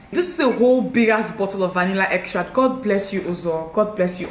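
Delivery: ordinary talk, nothing marked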